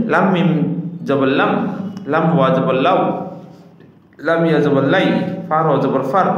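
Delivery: careful, beautiful recitation. Shown as a demonstration. Teacher models how every letter should be read, beautiful recitation. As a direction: recite each letter group distinctly, with projection. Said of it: "এভাবে সুন-, সুন্দর করে".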